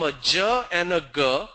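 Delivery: "J" as juh and "G" as guh; letter sounds. Shown as a demonstration. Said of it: "same letter G can become".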